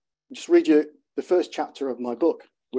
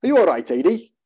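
light Welsh lilt, concerned; Welsh accent; 4 semitones higher, 6 dB louder than usual. Acted. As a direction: voice a Welsh mate checking in. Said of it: "a Welsh accent".

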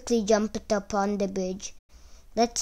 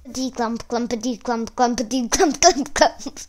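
heavy stomping; 3 semitones higher, 4 dB louder than usual. A narrator's reading. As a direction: let the clumps grow heavier and louder. Big goat stomps to meadow.

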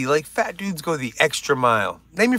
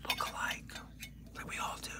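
whispered aside; 22 dB quieter than usual. Real, not acted. Aside to viewers.

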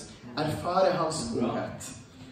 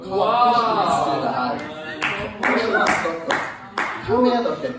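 amazed questions; 2 semitones higher, 4 dB louder than usual. Retold or imitated. Healed girl asks amazed.

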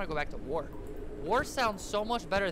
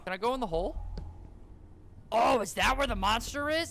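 normal talking voice

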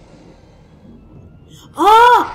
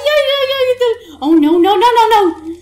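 panicked; 8 semitones higher, 6 dB louder than usual. Real, not acted. Panicking at the controls.

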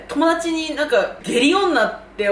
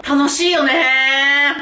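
drawn-out delight; 3 semitones higher, 5 dB louder, much slower than usual. Real, not acted.

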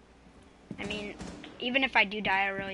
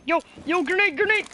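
grenade warning yell; 6 semitones higher, 4 dB louder than usual. Real, not acted. Teammate warns of incoming grenade.

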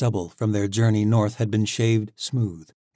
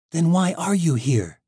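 higher, louder, hostile demand; challenging; 9 semitones higher, 8 dB louder than usual. Acted. Tori challenges Alec's reason for coming, higher and louder. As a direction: push him to explain himself.

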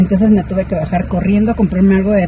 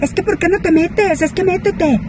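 high-pitched mock yell; grito imitado; 9 semitones higher than usual. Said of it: "gritándome". (grito fingido y agudo)

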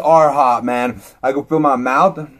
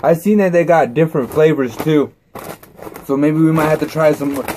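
ordinary talk, nothing marked

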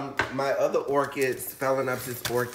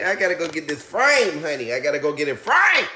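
excited; 3 semitones higher, 8 dB louder, faster than usual. Real, not acted. Excited about a gift.

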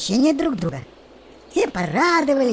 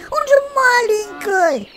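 much higher, louder frightened plea; alarmed cry; 12 semitones higher, 3 dB louder, slower than usual. A narrator's reading. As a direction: cry out to stop the cat. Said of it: "встревоженно воскликнул цыплёнок".

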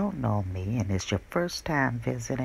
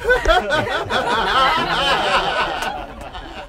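long loud burst of laughter; big cackle that trails off; 10 semitones higher, 9 dB louder than usual. Real, not acted. Laughs after showing the clip.

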